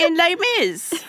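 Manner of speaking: high, slow mock British accent; British accent as French. Co-host spoofing British-voiced French characters in a mock British accent. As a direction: draw it out in hammed-up British. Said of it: "they're British".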